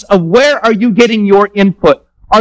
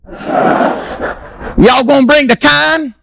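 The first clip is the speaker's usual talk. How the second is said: very slow, high Southern drawl into Pidgin; Southern Pidgin mix; 5 semitones higher, much slower than usual. Retold or imitated. Pastor mocking a Baton Rouge newcomer's Southern-accented Pidgin. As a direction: drawl it out like a Louisiana guy speaking Pidgin. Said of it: "Southern fried pigeon".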